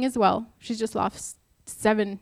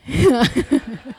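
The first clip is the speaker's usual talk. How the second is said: loud burst of real laughter; amused laugh; 4 semitones higher, 8 dB louder than usual. Real, not acted. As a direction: laugh out loud at your own story. Laughs about piece bothering husband.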